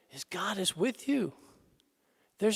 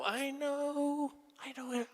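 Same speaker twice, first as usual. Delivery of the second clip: much softer, gentle reassurance; soft comforting; 3 semitones higher, 8 dB quieter than usual. Retold or imitated. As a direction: murmur comfort to a baby. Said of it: "the mom picks it up or the dad".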